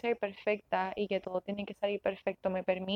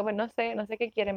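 ordinary delivery

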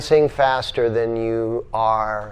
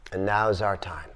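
breathy hushed whisper, much softer; 6 semitones lower, 11 dB quieter than usual. Acted. Teacher whispering a solemn moment to the yoga class. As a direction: lean in and whisper it like a secret.

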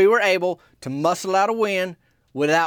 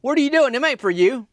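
higher, louder challenge; mock confrontation; 9 semitones higher, 5 dB louder than usual. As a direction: warn him off the donuts. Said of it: "them boys move toward".

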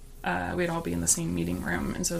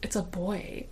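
softer, hushed; quiet prediction; 7 dB quieter, slower than usual. Retold or imitated. Coworker holding her elbow, softly predicting a boy. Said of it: "she goes".

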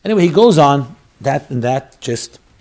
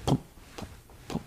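breathy voiceless pum pum pum heartbeat; whispered heartbeat; 18 dB quieter, faster than usual. Retold or imitated. Rabbi recreating the womb heartbeat for listeners, whispered. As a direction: whisper the heartbeat very softly, like it's far inside. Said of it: "and you hear it".

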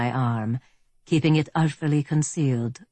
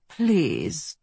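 put-on lightly German-accented villain; a hint of polite German menace; 2 semitones higher than usual. A narrator's reading. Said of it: "in a fine German accent".

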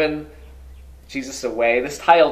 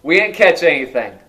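holler back; 8 dB louder than usual. Acted. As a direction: yell the answer back to shore. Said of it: "They holler back".

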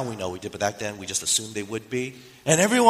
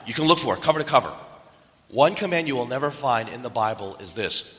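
usual speaking voice

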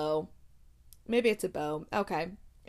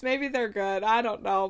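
usual speaking voice

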